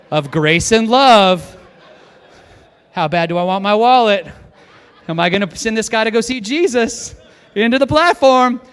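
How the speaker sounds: loud, intense inner debate; hammed-up agonizing, voiced loud and high; Scott's inner struggle. Preacher acts out the pastor weighing his wallet against a life, loud and torn.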